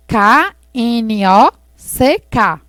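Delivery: usual speaking voice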